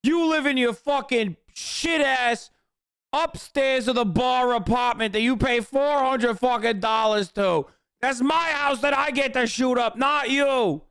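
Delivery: high, mock-indignant ranting voice, building to a shout; imitating the angry man. Streamer voicing the man in the video scolding Bacchus, mock indignant shout. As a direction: play the possessive husband claiming his right, hammed up. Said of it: "it almost feels like he's like".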